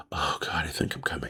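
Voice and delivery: low, breathy whisper; whispered moan. Retold or imitated. Teller imitating the woman whispering her climax. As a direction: whisper it as if overcome.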